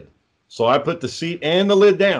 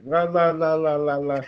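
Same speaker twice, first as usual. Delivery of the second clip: lightly sung la la la, mimicking idle phone talk; mock phone chatter; 3 semitones higher than usual. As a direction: babble like a guy chatting away on his phone.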